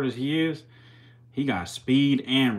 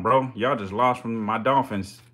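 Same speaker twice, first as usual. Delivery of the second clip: usual speaking voice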